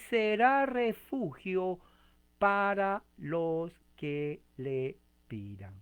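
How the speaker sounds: lento y enfático, recalcando "será refugio"; lectura enfática. Acted. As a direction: alarga cada palabra con solemnidad. Lectura enfática del versículo. (énfasis solemne y lento al leer "será refugio para los que le pidan")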